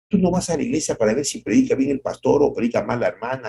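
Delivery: ordinary delivery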